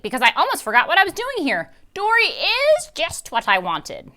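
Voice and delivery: high, loud greedy witch voice; a hungry old witch, eager; Mrs Gobblecracker voice. A narrator's reading. Witch tells Dory's sister she wants Dory, high and loud.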